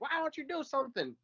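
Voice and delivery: very high, soft frustrated whine; exasperated plea. Retold or imitated. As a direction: squeak it out in frustration. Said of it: "He's like".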